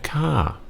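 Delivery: ka starting high and falling. Shown as a demonstration. Teacher showing the female particle, high and falling. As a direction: throw a falling tone onto ka. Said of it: "you'll be throwing a falling tone on that".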